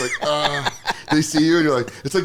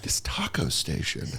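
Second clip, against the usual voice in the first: hushed whisper about the food; a sneaky whisper at a sad party; 8 semitones lower, 14 dB quieter, slower than usual. Retold or imitated. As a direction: whisper it like a secret. Mocking partygoers eyeing food.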